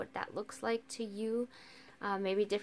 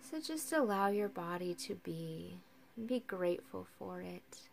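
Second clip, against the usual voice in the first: calm, unhurried guiding with long silences; guided meditation; 4 semitones higher, 8 dB quieter than usual. Acted. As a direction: ease listeners into rest, leaving space for each breath.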